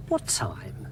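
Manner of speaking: hushed breathless whisper. A narrator's reading. David hiding below the rock, whispering. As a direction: whisper so the king won't hear.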